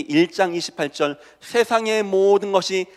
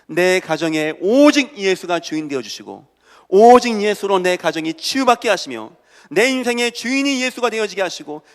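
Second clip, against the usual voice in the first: loud, raised-pitch pleading prayer; fervent prayer; 3 semitones higher, 5 dB louder than usual. Acted. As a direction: plead with God for the household, dropping at the close. Models a prayer to God. (간절하게 부르짖는 기도)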